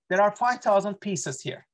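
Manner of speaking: usual speaking voice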